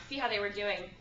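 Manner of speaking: normal talking voice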